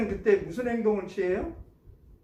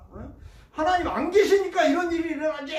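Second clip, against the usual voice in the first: higher, louder scoffing with a laugh; mocking complaint; 8 semitones higher, 5 dB louder than usual. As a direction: scoff at God, half laughing. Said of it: "막 하면서 원망하고". (하나님을 원망하며 비웃듯 높고 크게)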